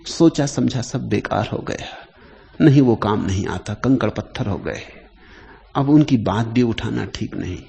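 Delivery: normal talking voice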